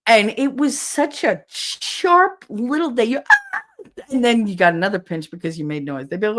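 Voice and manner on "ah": high, loud mock yelp; pinched yelp. Grandchild pinched by grandmother, sudden high yelp.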